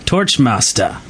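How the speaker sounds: ordinary talk, nothing marked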